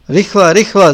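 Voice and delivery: sudden urgent exclamation; urgent call. A narrator's reading. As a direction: call out in haste.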